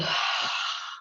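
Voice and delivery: long, soft unvoiced exhale; audible sigh of release; releasing sigh. Shown as a demonstration. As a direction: let the breath out in a sigh. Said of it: "we release it with a little".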